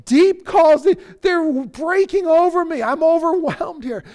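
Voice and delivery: loud, high cry of distress; overwhelmed cry. Acted. Psalmist overwhelmed by the waves, loud high cry. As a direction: call out as waves break over you.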